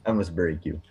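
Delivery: low, menacing mock-Russian accent; low-pitched attempt at a Russian accent; bad Russian accent. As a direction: do a poor Russian tough guy. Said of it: "I can't do a Russian accent".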